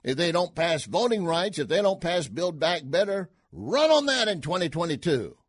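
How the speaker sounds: lightly mock Cajun advice; imitating Carville's Cajun voice; Carville impression. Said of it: "He said".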